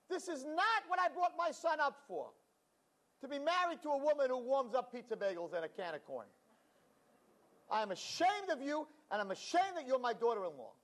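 high, shrill-pitched scolding tirade; mother-in-law lecturing her daughter-in-law in outrage; indignant mother-in-law. Retold or imitated. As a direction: shame her for the lunch.

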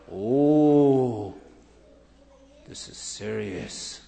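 grave officer. Acted. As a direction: drop it low and slow, like bad news.

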